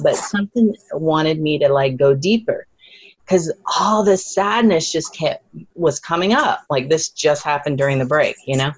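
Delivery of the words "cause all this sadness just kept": usual speaking voice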